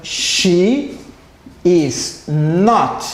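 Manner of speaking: "She is not" read out slow and careful for the class; slow demo read. Shown as a demonstration. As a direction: model "She is not" slowly, word by word. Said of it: "Aí eu tenho o não". (lendo "She is not" devagar, como modelo)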